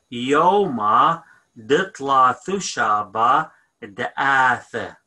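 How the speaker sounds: "Yoma ditla thushaba da'ath" said slowly, every syllable spelled out; slow, careful teacher model of "Yoma ditla thushaba da'ath"; syllable by syllable so students can copy; slow model phrase. Shown as a demonstration. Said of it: "So we're gonna say".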